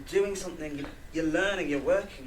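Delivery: passionate outburst. Acted. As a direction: blurt it out with envy and admiration. Emotional outburst to a friend.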